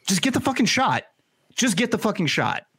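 high-pitched, insistent plea; exasperated urging. Real, not acted. Urging listeners to get vaccinated.

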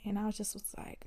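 normal talking voice

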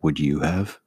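deadpan Ron turning the question around. Acted. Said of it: "you're gonna be Ron".

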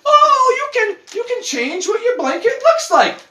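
high chirpy ad voice; mock salesman. Mocking duvet marketing with a high chirpy pitch. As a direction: pitch it like a perky ad.

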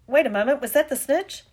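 match commentator getting carried away; sports commentary. A narrator's reading. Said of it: "Lee Jordan was saying".

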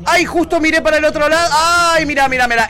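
agudo y chillón, fingiendo sorpresa; un rey que se hace el distraído; rey remilgado. Retold or imitated. Imita al rey Carlos esquivando. (rey fingiendo distracción)

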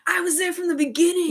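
high, loud gushing superfan; stan fan voice. Retold or imitated. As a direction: gush like a proud early fan. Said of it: "one of those stans that was like".

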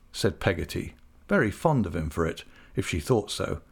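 ordinary delivery, nothing marked

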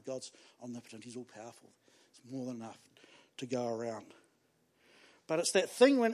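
normal talking voice

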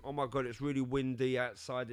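usual speaking voice

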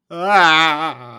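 loud, high ghostly wail. Pretend. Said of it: "I'm gonna make a ghost sound".

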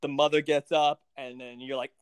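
usual speaking voice

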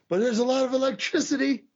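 mocking a punch-drunk wrestler cutting a promo; Kerry impression. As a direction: ham up a confused wrestler's promo. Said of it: "He goes".

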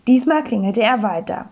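ordinary delivery

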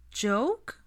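high, doubtful rising question; suspicious child. A narrator's reading. A little boy doubts his aunt's explanation, suspiciously. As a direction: question it warily, like a small boy. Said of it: "Aurelian asked suspiciously".

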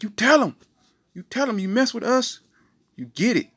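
high, loud mock bragging; boastful family. Family boasting to the world, high and loud. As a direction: crow it like a proud family.

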